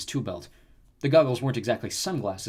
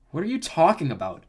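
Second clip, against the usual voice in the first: mildly indignant, higher question; demanding; 6 semitones higher, 4 dB louder than usual. A narrator's reading. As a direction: demand it with a touch of offense. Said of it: "Narcissus demanded".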